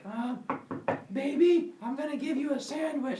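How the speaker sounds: put-on high, coaxing baby talk. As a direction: coo at the baby in the box. Pandora talking to box baby.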